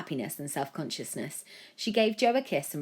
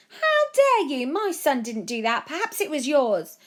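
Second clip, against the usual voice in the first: much higher, louder indignant yell; indignant parent; 10 semitones higher, 8 dB louder than usual. A narrator's reading. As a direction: snap back in outrage. Other parent fights back.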